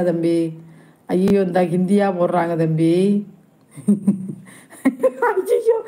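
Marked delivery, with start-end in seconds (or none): 5.2-5.8 very high squeal of dismay; high-pitched wail. Real, not acted. Host faced with Hindi comments, high-pitched wail. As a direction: squeal it, rising at the end.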